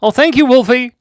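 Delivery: high, loud delighted thank-you; squealed thank you; excited thanks. Real, not acted. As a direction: gush your thanks.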